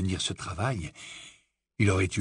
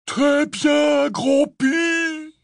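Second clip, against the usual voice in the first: very high, loud far-carrying yell; 6 dB louder, much slower than usual. A narrator's reading. Hagrid yelling to Grompy through the broken panes, hollering. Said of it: "hurla-t-il à travers les carreaux brisés".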